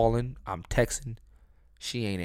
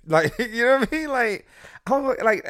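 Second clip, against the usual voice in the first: laughing; 12 semitones higher, 6 dB louder than usual. Real, not acted. Laughing at own story.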